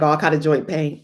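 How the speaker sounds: ordinary delivery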